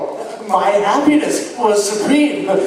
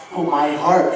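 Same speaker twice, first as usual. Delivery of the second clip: plain reading voice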